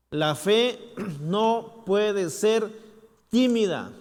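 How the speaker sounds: loud, slow, each word driven; emphatic proclamation. Shown as a demonstration. Preacher declaring to the church, loud and slow. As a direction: declare it with force. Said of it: "repeated line".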